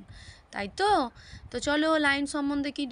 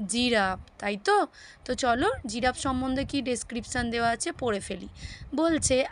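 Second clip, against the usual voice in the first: normal talking voice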